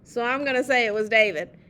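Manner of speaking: plain reading voice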